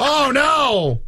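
mock dismay. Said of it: "He's like".

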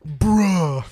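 drawn-out mocking imitation of a co-host; teasing put-on voice; Keisha voice. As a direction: mimic a friend's bruh. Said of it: "That's my new Keisha voice".

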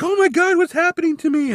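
high frantic cry of alarm; panicked widow. Acted. Widow panicking as her eye bleeds, high frantic shriek. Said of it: "And she's like".

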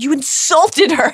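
high, drawn-out mock shout. A narrator's reading. Ron defending his crush, half-hearted shout. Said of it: "shouted Ron".

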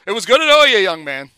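hearty, loud goodbye to a reporter whose name he never knew; gruff old coach. Retold or imitated. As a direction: boom it out like a clueless old-timer shaking hands. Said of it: "he goes".

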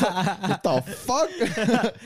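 high, loud, drawn-out disbelief; laughing, acted-out astonishment; shocked outburst. New dad reacts to the drug, loud incredulous outburst.

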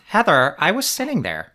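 high, loud mock-earnest impression; earnest Shannon. Imitating housewife who lost her seat, earnest protest. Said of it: "she does quite earnestly go".

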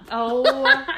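normal talking voice

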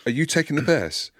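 high-pitched, incredulous re-enacted question; light, joking tone. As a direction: ask it high, half in jest. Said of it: "I just said it like that".

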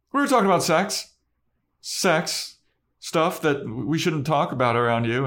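high, fast blurt, then easing off; acted-out overeager confession; mock blurted excuse. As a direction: blurt out the cover story, hammed up. Anya blurting an awkward cover story.

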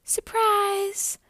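high, loud happy cry; little friends bursting out; squealed surprise; excited cry. A narrator's reading. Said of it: "cried her little friends".

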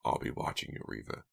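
quiet, unvoiced menace; menacing. A narrator's reading. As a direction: threaten him softly. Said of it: "he said with sudden menace".

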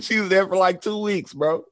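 high, amused disbelief through a laugh. Real, not acted. Cracking up with co-host over the sudden French accent, laughing.

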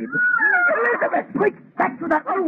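urgent shout. Acted. Warning Elizabeth that the monster is near, shouting. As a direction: cry out to warn her of the monster.

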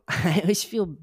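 laugh-wheeze through the words; wheezy voice. Real, not acted. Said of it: "I'm wheezing really bad".

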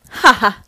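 loud mocking ha ha burst; gloating cackle. A narrator's reading. Thunderwing cackles as Blaze spins out, loud and gloating. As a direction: cackle over a beaten rival. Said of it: "cackled Thunderwing".

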